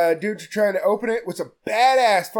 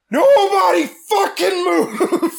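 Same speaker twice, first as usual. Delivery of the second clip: higher, louder, slowed shout broken by a laugh; gun-pointing shout; 11 semitones higher, 5 dB louder, slower than usual. Retold or imitated. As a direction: bark it like a hammed-up stickup, laughing through it. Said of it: "and goes".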